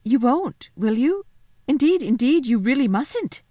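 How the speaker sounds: put-on high, urgent little-girl plea; anxious pleading. Lucy begging the faun not to betray her, pleading. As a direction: plead with rising alarm.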